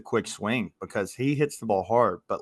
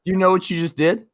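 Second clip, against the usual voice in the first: retold excited coach; 7 semitones higher, 8 dB louder than usual. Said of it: "And I'm like".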